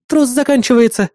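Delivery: high-pitched female assistant alert; warning assistant. A narrator's reading. Assistant warns hero the cable is ending, high alert voice. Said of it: "предупредила Эрис".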